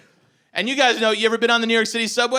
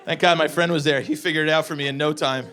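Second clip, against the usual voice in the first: ordinary delivery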